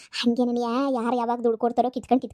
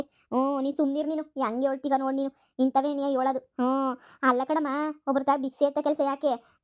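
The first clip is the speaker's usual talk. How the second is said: ordinary talk, nothing marked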